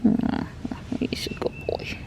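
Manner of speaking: whispered doting praise; squeaky praising a dog. Real, not acted. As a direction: breathe it out softly to the pet.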